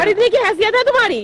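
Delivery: loud, high, indignant challenge; angry put-down. Real, not acted. Woman challenges man to pay for glasses, loud and angry. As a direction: belittle him at full voice.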